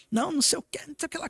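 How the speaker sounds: high-pitched mock yell, fading at the end; retold yelling. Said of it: "Começou a gritar".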